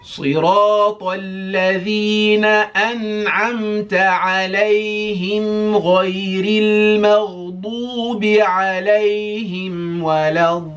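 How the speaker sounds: high, loud melodic chant; Quran recitation. Shown as a demonstration. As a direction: recite it in one flowing melody. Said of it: "I'll just demonstrate how we say that".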